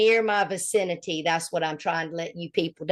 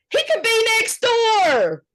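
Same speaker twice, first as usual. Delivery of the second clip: mock alarm; 13 semitones higher, 4 dB louder than usual. Real, not acted. Teasing alarm about neighbor.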